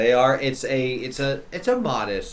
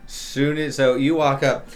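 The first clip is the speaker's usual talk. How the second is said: usual speaking voice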